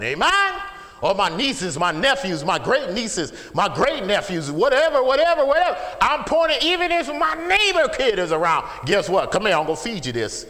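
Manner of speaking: high, loud pulpit shout; impassioned shouted testimony; full-force preaching. Real, not acted. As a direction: cry it out to the whole congregation.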